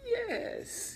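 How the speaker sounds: high hissing Igor voice; little Igor. Acted. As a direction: hiss it like a hunchbacked helper. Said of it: "he hissed like a little Igor".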